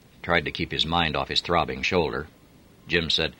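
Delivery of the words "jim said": plain reading voice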